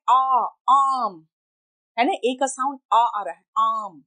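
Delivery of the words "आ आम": आम with a long stretched आ; slow stressed aa sound; drawn-out aa. Shown as a demonstration. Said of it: "अ का sound आ रहा है".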